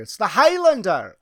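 booming, high mock-Scottish proclamation; Scottish accent. Game master joking about an earlier accent, loud hammed-up Scottish. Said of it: "I was doing a Scottish accent earlier".

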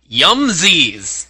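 loud gushing exclamation; excited. Retold or imitated. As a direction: squeal it out with delight. Reading the letter's gushing sign-off.

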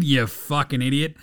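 normal talking voice

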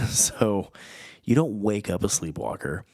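usual speaking voice

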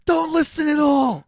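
loud, high mock yell; mock shout. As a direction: shout it like a joking co-host. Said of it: "Then you say".

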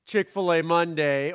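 Chick-fil-A Monday said like the restaurant name plus Monday; punny pronunciation. Shown as a demonstration. Pastor joking to the congregation about saying Philemon, Chick-fil-A Monday pun. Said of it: "here's one way that you can say it".

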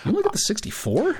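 surprised. Real, not acted. Mocking surprise at smaller phone.

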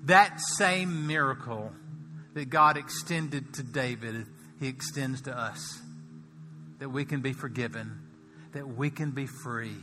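soft, low, tender appeal; preacher softly leading a prayer of confession; gentle altar call. Acted. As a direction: draw them in gently.

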